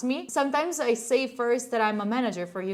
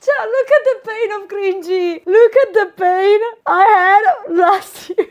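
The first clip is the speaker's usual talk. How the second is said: louder, half laughing half crying; looking back at last year, laugh-crying; 7 semitones higher, 12 dB louder than usual. Real, not acted. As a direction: wail at your own past pain. Laughing-crying at old self.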